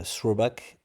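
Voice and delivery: French-accented English word; bad English accent. Real, not acted. Trader repeating the term for viewers, bad English accent. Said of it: "mon accent anglais est immonde".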